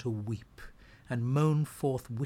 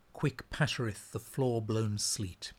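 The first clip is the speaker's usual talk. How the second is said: plain reading voice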